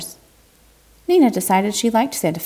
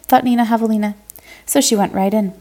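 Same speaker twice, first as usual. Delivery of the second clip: plain reading voice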